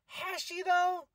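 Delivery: very high-pitched doubtful query; sceptical questioning. Retold or imitated. Host doubts [name]'s big impact, high-pitched sceptical reply.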